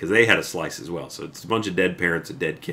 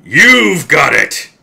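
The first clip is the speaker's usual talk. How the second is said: sudden much louder, higher, slower roar; jump scare shout; 10 semitones higher, 17 dB louder, much slower than usual. Acted. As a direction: blast it to make them jump. Jump scare ending of story.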